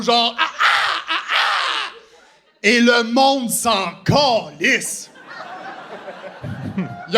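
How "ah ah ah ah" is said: animal chorus. Retold or imitated. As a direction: bark out wild animal calls. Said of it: "je fais des cris d'animaux".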